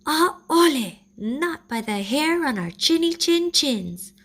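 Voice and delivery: high, loud refusal; defiant piglets. A narrator's reading. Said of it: "Both pua'a answered".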